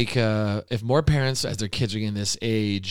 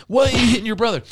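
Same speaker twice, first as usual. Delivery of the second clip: high, loud, exasperated demand; mock parental yelling; 11 semitones higher, 6 dB louder, faster than usual. Dad catching kids fighting, mock yelling. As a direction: scold as an imitation of an overwrought parent. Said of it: "as opposed to just screaming, yelling".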